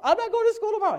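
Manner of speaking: put-on high, bouncy kid chant; high sing-song certainty. Son telling himself there's no school, high sing-song.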